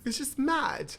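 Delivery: put-on high, playful British accent; best UK accent. Host agrees it's scandalous, mock UK accent. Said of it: "give me your best U K accent".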